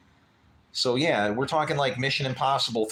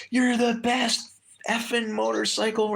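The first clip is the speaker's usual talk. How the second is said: retold shout; 10 semitones higher than usual. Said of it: "he was screaming it".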